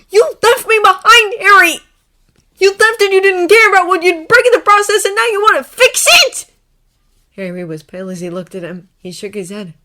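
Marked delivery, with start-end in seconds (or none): 0.1-6.5 high, very loud tirade; furious accusation. A narrator's reading. Draco accusing Harry of leaving him, furious shouting. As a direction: hurl the hurt at him. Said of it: "he snapped".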